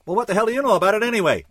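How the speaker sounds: fast, high, loud indignant retort; snapping back. A narrator's reading. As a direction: fire it back, stung. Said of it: "thought Chuck was being sarcastic and snapped back".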